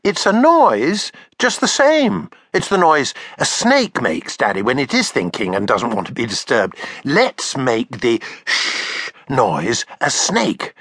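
put-on high eager little girl; child voice. Taffy copying her father's drawing: high eager child voice.

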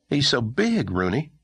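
put-on young boy voice. Boy character voice.

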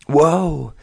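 high, loud startled yell. A narrator's reading. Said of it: "she yelled".

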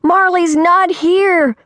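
high, drawn-out celebratory cheer; gleeful yell. A narrator's reading. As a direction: stretch it out in a high, jubilant call. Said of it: "clapping and yelling".

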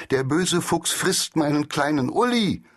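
höher, hastiger Hilferuf; lauter Ruf. Acted. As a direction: ruf verzweifelt um Hilfe für Uli. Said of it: "rief der Angsthase laut". (Angsthase ruft laut, weil der Fuchs Uli frisst)

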